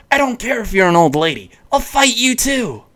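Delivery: high, scrappy challenge, rising in pitch; defiant boy. A narrator's reading. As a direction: play a cocky kid picking a fight.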